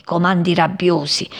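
ordinary delivery

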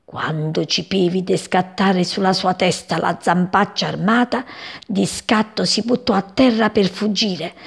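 ordinary delivery, nothing marked

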